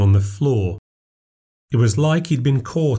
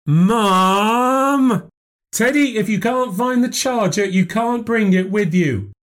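calling upstairs; 13 semitones higher, slower than usual. Acted. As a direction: shout it up to the boy upstairs. Shouting up the stairs.

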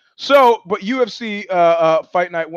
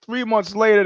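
ordinary talk

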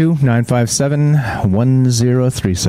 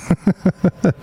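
laugh; 6 semitones higher than usual. Real, not acted. Amused at teaching ducks.

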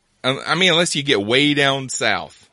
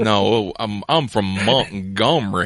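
slow, hammed-up old Southern gentleman; exaggerated Southern drawl. Mocking movie Southerners, slow hammed-up Southern drawl. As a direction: drag it out like a cartoon colonel. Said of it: "foghorn leghorn Colonel Sanders talk".

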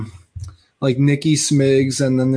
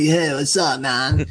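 own surfer voice; slower than usual. Retold or imitated. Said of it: "I sound like".

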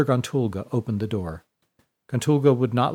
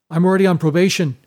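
panicked; 6 semitones higher, 6 dB louder, faster than usual. Acted. Panicked brother explaining.

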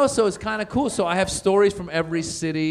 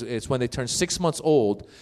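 normal talking voice

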